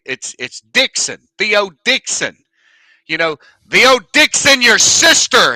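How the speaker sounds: loud, high, stammering prank caller voice; spam call persona. Pretend. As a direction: play the bumbling phone prankster, loud and high. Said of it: "I have created a spam call persona".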